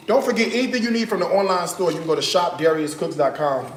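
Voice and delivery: quick plug for the online store; ad read. Acted. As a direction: rattle off the promo. Plugs store discount.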